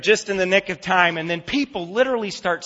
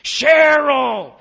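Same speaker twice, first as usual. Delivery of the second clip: loud, high drawn-out yell of a name; angry scream; 8 semitones higher, 8 dB louder than usual. Retold or imitated. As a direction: bellow for your wife across a crowded terminal. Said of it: "he got louder and madder".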